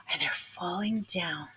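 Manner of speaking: unvoiced whisper; hushed dread. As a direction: whisper it in fear. Seeds falling, hushed dread.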